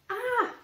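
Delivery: high, short startled scream; storybook scream of alarm. A narrator's reading. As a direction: cry out in fright, high but contained. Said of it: "Jack screamed".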